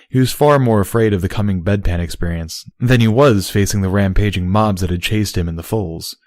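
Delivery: ordinary delivery, nothing marked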